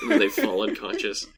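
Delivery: usual speaking voice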